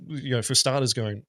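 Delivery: usual speaking voice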